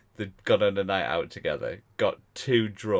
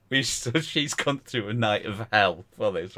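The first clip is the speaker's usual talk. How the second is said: ordinary delivery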